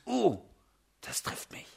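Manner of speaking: breathless whisper, moved; whispered realization. Retold or imitated. Reader suddenly struck by a Bible verse, whispering. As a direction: whisper it as if the verse just hit home.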